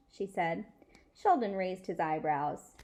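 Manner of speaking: ordinary delivery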